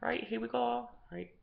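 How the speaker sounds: ordinary talk, nothing marked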